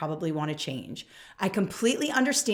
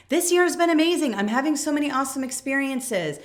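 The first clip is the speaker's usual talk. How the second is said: mock gushing; 6 semitones higher than usual. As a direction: ham up the fluffy enthusiasm. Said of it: "a lot of fluff".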